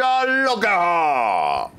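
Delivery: long drawn-out showy call; hammy, held-out announcer call; game-show catchphrase. Pretend. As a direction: stretch every word like a game-show host. Host calls the catchphrase.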